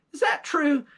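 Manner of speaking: high, sharp disbelieving question; surprised question. Acted. Old man turns to his wife, surprised. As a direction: ask it shocked.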